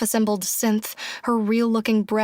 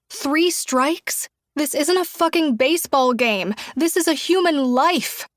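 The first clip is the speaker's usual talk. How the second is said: raised, high outraged protest; 4 semitones higher than usual. A narrator's reading. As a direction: protest it passionately. Julia's passionate outrage.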